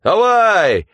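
high, loud yell; gleeful yell. Acted. Mitka yells at the waves while swimming. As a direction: roar at the wave. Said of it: "орал Митька".

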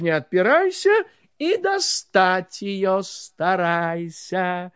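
plain reading voice

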